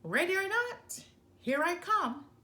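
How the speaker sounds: high and loud hunting call; wolf calling down the chimney, high and loud; gleeful wolf call. A narrator's reading. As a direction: sing it out as the wolf drops in. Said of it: "the wolf growled".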